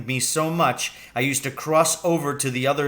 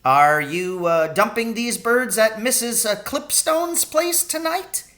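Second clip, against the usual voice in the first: put-on higher old cabbie voice, rising; curious old Charlie; 9 semitones higher than usual. Old cabbie asking Willem about the birds, higher curious voice. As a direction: ask it like a nosy old rogue.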